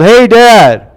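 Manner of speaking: put-on high, loud boy's call; child yelling. Boy leaping off a rock yelling to his dad. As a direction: yell it as you leap off the rock. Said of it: "he jumped and he yelled".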